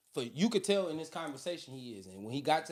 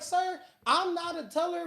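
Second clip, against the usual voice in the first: high, slow, prissy lady voice; mock prim banker lady; 11 semitones higher, 4 dB louder than usual. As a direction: play the bored banker brushing him off slowly. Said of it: "she was like".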